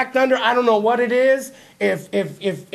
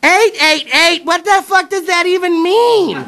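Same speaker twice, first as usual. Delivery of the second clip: put-on higher, slow, puzzled girlfriend voice; 6 semitones higher, 3 dB louder, slower than usual. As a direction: squint and puzzle it out slowly. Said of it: "she's like".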